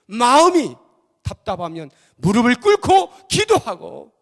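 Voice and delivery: loud, high-pitched sermon cry; full-force preaching. Acted. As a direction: preach it urgently at the top of your voice. (힘껏 외치는 설교)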